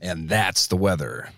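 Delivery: slow, lightly voiced radio announcer sign-off; radio guy. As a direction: sign off like a mock weatherman. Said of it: "look like a radio guy".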